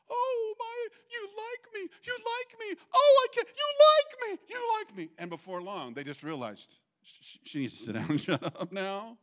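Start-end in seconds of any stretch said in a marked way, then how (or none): 0.1-5.1 squealing high, loud overjoyed award speech; Sally Field gushing. Retold or imitated. Preacher imitates Sally Field's award speech, shrieking high. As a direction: overdo the thrilled thank-you, shrieking. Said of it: "she stands up in front of everybody and goes".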